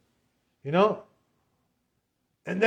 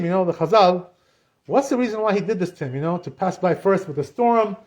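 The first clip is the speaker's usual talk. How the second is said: ordinary talk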